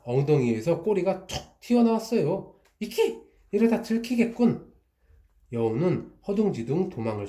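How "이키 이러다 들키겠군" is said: yelp, much higher, then a worried mutter; startled fox. Acted. Fox startled as its tail pops out, high yelp. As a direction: caught off guard, squeak in alarm.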